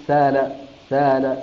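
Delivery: سال said slowly with a long ā, no hamza; slow demo. Shown as a demonstration. Teacher slowly repeats Qalun's سال for students. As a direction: draw out سال, sāl, smoothly. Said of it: "أبدلها ألف".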